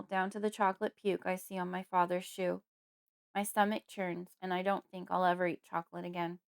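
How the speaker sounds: ordinary delivery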